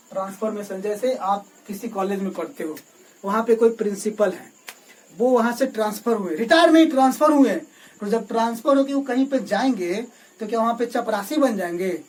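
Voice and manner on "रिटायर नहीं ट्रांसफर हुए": loud, raised stress on "retire नहीं"; emphatic correction. Real, not acted. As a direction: punch "retire नहीं", then "transfer हुए", loudly. Emphatic correction of a likely mishearing. ("Retire नहीं" पर ऊँची आवाज़ में ज़ोर)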